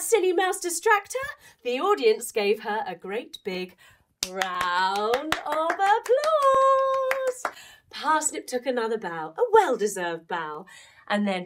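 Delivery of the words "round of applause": big cheering announce. A narrator's reading. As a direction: stretch it like a circus ringmaster. Audience cheering.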